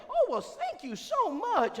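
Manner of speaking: high falsetto, slow and drawn out; slow falsetto imitation of Brenda; Brenda's voice. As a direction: gush out thanks in her voice. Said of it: "Brenda speaks".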